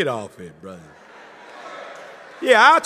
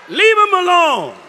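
minister's advice to the preacher, raised and firm; emphatic minister; 5 semitones higher, 4 dB louder than usual. Retold or imitated. The minister to the preacher, firm and raised. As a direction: lean in and say it firmly. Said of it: "And the minister said".

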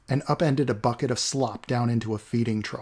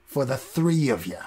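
smug. Acted. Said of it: "he said smugly".

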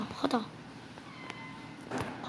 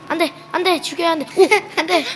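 high, loud panicked cries; 6 semitones higher, 6 dB louder, faster than usual. Real, not acted. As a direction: cry out as things go wrong. Panic in fight. (다급한 비명)

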